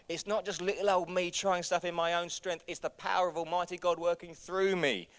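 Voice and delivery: loud, high, fired-up preaching; preaching at full force. Acted. Pastor rallying the church on boldness and power, preaching loud. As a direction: drive every line home to the room.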